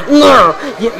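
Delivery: ordinary talk, nothing marked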